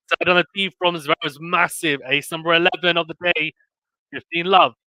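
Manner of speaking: energetic sports commentary; play-by-play. Real, not acted. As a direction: hype up the big serve. Live tennis play-by-play.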